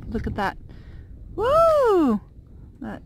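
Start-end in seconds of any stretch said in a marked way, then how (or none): 1.3-2.2 excited. Real, not acted. Reacting to a gust.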